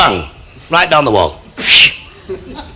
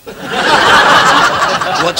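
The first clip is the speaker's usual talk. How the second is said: far slower and higher question; very slow drawl; 11 semitones higher than usual. Acted. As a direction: stretch every word out. Landlord asks, drawn out.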